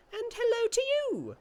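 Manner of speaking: very high, loud, slow chirpy little creature; chirping creature. Acted. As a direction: chirp it like a tiny winged sprite.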